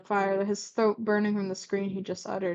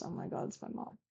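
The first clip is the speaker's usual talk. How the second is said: hushed unvoiced gasp of recognition; quiet surprised whisper aside; 3 semitones lower, 14 dB quieter than usual. Real, not acted. Aside reacting to the story.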